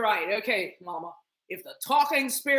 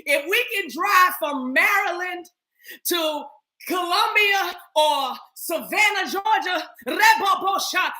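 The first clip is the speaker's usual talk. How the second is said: preaching shout; 4 semitones higher than usual. Acted. Mock preaching in tongues.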